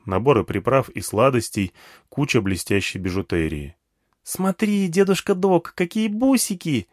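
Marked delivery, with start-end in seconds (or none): 4.2-6.9 put-on high, thrilled child voice; excited chirpy girl, much higher; delighted little girl. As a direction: show off a treasure like a small girl. Girl shows off her beads.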